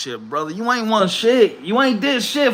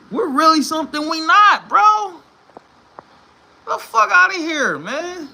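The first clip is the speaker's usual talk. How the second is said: frustrated shout; 9 semitones higher than usual. Real, not acted. Frustrated fan rant.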